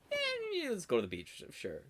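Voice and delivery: a high "eh" falling into an easy agreement; casual shrug. Retold or imitated. Eun-chan agreeing to the beach trip with a shrug. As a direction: shrug and go along. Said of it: "ever the best friend".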